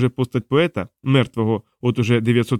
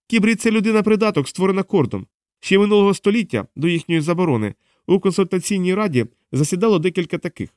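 high-pitched, impatient explainer; insult, then explain in a high voice; exasperated woman; 9 semitones higher than usual. Acted. Diana lectures a henchman on cybrids, high and exasperated.

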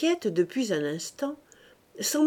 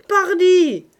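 much higher and louder, furious outburst; furious cry; 10 semitones higher, 12 dB louder than usual. A narrator's reading. Cook yelling back at her master, furious. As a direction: shout it from down the corridor. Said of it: "la voix furieuse de la cuisinière".

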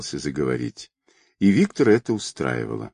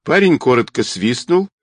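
ordinary delivery, nothing marked

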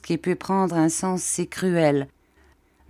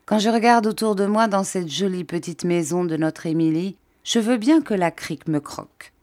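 usual speaking voice

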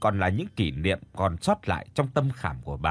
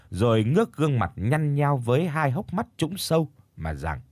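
ordinary delivery

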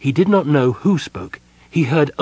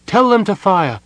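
loud, much higher pleading orders; urgent command; 9 semitones higher, 5 dB louder than usual. Acted. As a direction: rouse him to act, urgently. Mina urging king to command.

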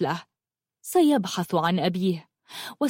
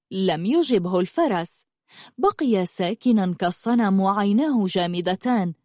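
plain reading voice

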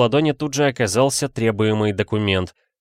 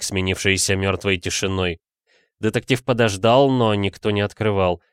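usual speaking voice

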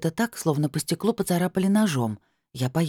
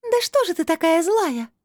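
very high otherworldly voice; crystal voice reproach; 4 dB louder than usual. A narrator's reading. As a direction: complain in high, ringing tones. Disembodied voice reproaches heroine. (высоким голосом, с упрёком)

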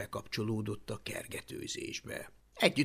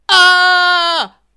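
loud high-pitched scream; long piercing "aah" yell; Hablaty's scream; 20 dB louder than usual. Acted. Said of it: "sikította Hablaty".

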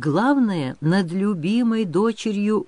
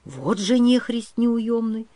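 slow, higher-pitched grumble; grumbling; 6 semitones higher than usual. A narrator's reading. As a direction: grumble in exasperation to yourself. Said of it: "пробурчал по-русски Григорий".

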